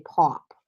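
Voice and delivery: "pop" with an "aw" vowel, like "paup"; "pop" as if spelt P A U P; Boston vowel. Shown as a demonstration. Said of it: "You get that awe in there".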